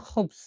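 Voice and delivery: খুবস starting with kh from the throat; teacher's demo of খুবস, throaty first consonant; throaty kh sound. Shown as a demonstration. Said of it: "গলা দিয়ে বের করতে হবে".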